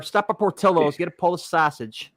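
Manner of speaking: exaggerated Chicago accent; thick Chicago accent. Shown as a demonstration. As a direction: deliberately overdo the Chicago accent. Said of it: "I deliberately did the accent".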